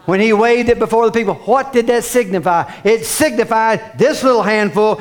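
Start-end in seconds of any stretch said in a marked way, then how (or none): none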